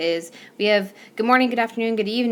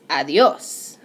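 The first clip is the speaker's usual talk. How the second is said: ADIOS slowly, punched on the final OS, ah-dee-OS; last-syllable stress; 3 semitones lower, 5 dB louder than usual. Shown as a demonstration. Teacher modelling correct "adios", stress on final OS. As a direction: hit the last syllable of "adios" loud and long. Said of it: "emphasis right there on that last syllable".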